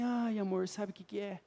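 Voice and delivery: soft, quiet sighing wife; a hint of the sorrowful wife; imitating wife's sadness. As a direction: voice her gentle melancholy lightly. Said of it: "ela virou e falou assim".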